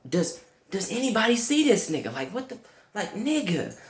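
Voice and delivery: high, fast incredulous plea to the room; disbelieving. Retold or imitated. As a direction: ask the room in mock disbelief. Disbelief at thief in church.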